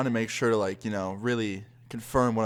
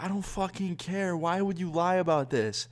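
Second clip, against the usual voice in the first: outraged; 8 semitones higher than usual. Retold or imitated. Learns friends lied, furious.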